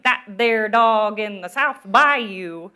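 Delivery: slow, loud, overdone New Orleans drawl; terrible New Orleans accent. Shown as a demonstration. Teacher demos a bad New Orleans accent for junior writers, hammed-up. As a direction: stretch every word like a hammy New Orleans local. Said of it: "I have a terrible New Orleans accent".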